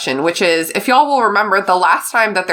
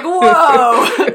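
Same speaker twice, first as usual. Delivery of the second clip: mock surprise; 9 semitones higher, 3 dB louder than usual. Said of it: "And it's like".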